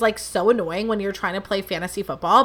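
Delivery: ordinary talk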